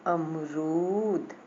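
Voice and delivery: अमरूद very slow, with a long रू; drawn-out word reading. Shown as a demonstration. Teacher shows the long vowel, drawn out. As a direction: hold the रू in अमरूद.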